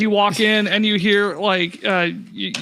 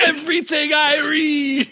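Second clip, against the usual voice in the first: high, cheerful put-on Jamaican; Jamaican accent; 7 semitones higher than usual. Shopkeeper repeating a player's line in Jamaican accent. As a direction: echo it with a Jamaican lilt. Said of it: "Everything's Irie".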